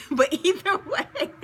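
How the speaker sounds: high, loud, slow laughing words; drawn out through real laughter. Real, not acted. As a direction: laugh your way back to the topic. Laughs after admitting she's struggling.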